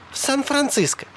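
very high woman's voice; light, high, unconcerned reply; blithe answer. A narrator's reading. Sally blithely names her destination.

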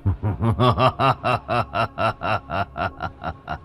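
long, staccato evil laugh; sinister laughter in a falling run; evil cackle. Acted. Possessed son gloating over his terrified father, evil cackle.

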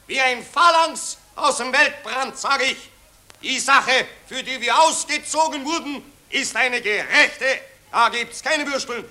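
pompous orator addressing the crowd; patriotic street speech. Acted. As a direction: harangue the citizens with fake grandeur. (pathetische Straßenrede)

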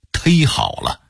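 忒好了 with 忒 said as "tēi"; Beijing local pronunciation. Shown as a demonstration. Teacher giving an example of dialect, 忒好了 with a local "tēi". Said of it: "北京人往往还说土音儿忒好了".

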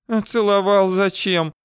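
high, plaintive female voice; near tears. A narrator's reading. Girl asks why he kissed her, near tears. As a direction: ask it high, with a touch of hurt. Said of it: "девушка вновь чуть не разревелась".

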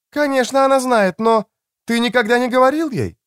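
high, insistent female reply; pressing woman's voice. A narrator's reading. Woman presses the same point.

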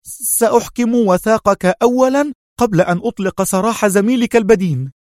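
high-pitched, sharp-tongued woman. A narrator's reading. Sonia setting her terms to Adham, sharply. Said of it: "ثم قالت في حدة".

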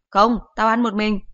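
curt mother. Acted. Mother teases.

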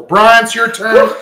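loud, high, drawn-out call. Real, not acted. Host calls the next player up, loud announcing call. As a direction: summon the next player loudly and slowly.

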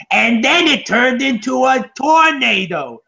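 high, slow, squawking Gilbert Godfrey voice; Gilbert Godfrey impression. Mocking the comedian's film cameo for a friend, in high slow squawking voice. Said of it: "Gilbert Godfrey was like".

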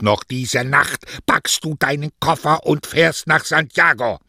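high, loud, indignant maternal outburst; furious mother, accusing. Acted. Mother denounces the plagiarist to her daughter, furiously.

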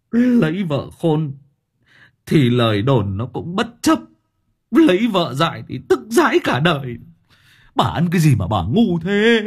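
put-on loud exasperated old man berating; husband shouting. A narrator's reading. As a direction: lose all patience and rant at her. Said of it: "Ông hét". (ông chồng hét mắng vợ)

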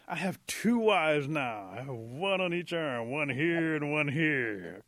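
loud, drawn-out crowing; gloating delight. Acted. Possum chosen by both girls, gloating. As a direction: savour it slowly and proudly.

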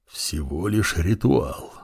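slow, soft hoarse wheeze; wheezing hiss. A narrator's reading. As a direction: wheeze it out slowly. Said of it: "просипел он".

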